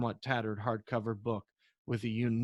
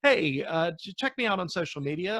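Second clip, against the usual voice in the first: usual speaking voice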